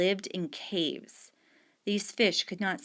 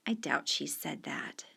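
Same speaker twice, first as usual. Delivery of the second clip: hushed doubt; 4 semitones lower, 7 dB quieter than usual. Acted. Mother doubting son's story.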